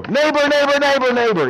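high, loud insistent calls; calling out. Acted. Man at the front door calling for his neighbor, loud and insistent. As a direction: hammer on the door with your voice.

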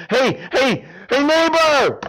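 high, loud call up to a window. Acted. Man at midnight calling up to his neighbor's window, loud high call. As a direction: shout up to wake the neighbor at night.

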